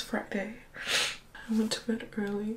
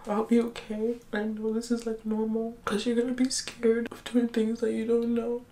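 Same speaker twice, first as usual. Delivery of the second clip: ordinary talk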